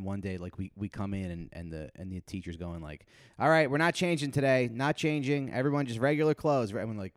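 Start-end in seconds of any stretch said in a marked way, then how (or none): 3.4-6.7 higher, louder teacher call; gym teacher announcing. Retold or imitated. Gym teacher telling students not to change, higher and louder. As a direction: announce it to the class.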